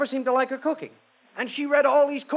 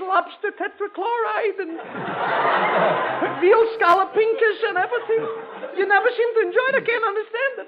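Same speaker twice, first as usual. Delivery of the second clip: tearful wife; 8 semitones higher than usual. Acted. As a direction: wail like a wife at her wits' end, half laughing. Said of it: "finally she's in tears".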